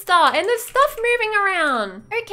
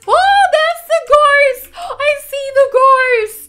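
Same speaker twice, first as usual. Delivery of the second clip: louder, higher, slowed cry; scared outburst; 7 semitones higher, 9 dB louder, slower than usual. Real, not acted. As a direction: blurt it, terrified of the ghost. Spots the ghost.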